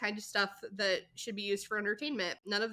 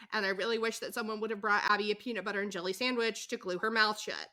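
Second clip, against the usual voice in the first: ordinary delivery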